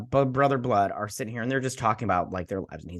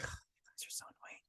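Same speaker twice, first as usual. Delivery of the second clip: breathless whisper of annoyance; whispered aside; 23 dB quieter than usual. Real, not acted. Recapping a show, he whispers scorn at the characters. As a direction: whisper it under your breath.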